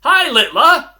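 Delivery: loud, high friendly holler; shouted greeting. Retold or imitated. Man hailing Luke Littler across there, shouted greeting. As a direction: call out across the street to someone famous. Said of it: "I shouted".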